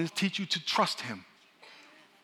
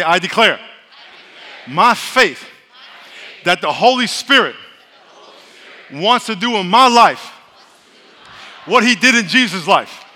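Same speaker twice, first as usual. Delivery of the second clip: loud preached declaration; shouted call for the congregation to repeat; 3 semitones higher, 13 dB louder than usual. Real, not acted. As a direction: lead the crowd, full voice.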